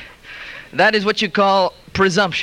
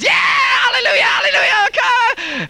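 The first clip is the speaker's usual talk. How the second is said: ecstatic crowd shout. Acted. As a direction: whoop it up like a revival crowd gone wild. Crowd goes bananas at the miracle.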